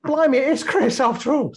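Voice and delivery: high, loud mock-amazed exclamation; retold burst of surprise, pitched right up; astonished recognition. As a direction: act out suddenly recognising an old workmate. Acting out his astonished recognition.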